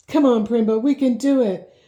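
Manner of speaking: high, loud call to the pony; urging on. Acted. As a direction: spur him on with your voice.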